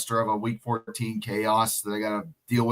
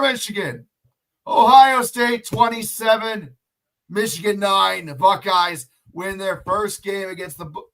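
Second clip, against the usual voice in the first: high, slow triumphant broadcast call; sportscaster voice; 9 semitones higher, slower than usual. Acted. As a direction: announce it like a play-by-play caller. Mock sports broadcast sign-off.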